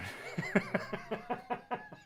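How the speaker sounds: real high-pitched giggling fit; long high laugh. Real, not acted. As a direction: crack up helplessly. Cracks up at own situation.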